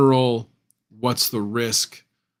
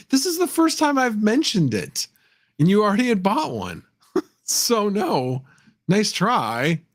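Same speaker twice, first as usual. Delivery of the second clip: high-pitched, badly done celebrity impression; Christopher Walken; 11 semitones higher, 3 dB louder than usual. Streamer teasing a chatter who already bought one, bad high-pitched impression. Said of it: "My Christopher Walken impression, which is really bad".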